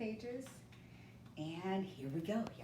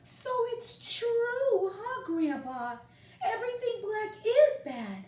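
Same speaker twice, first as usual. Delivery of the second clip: sad; 8 dB louder than usual. Acted. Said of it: "Moncho whispered sadly".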